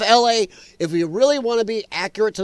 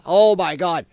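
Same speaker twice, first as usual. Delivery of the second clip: ordinary delivery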